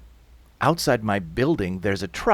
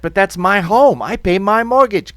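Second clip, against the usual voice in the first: high, very loud, indignant protest; shouting back; 9 semitones higher, 10 dB louder than usual. Acted. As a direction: yell back in defence of your home. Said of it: "are shouting back".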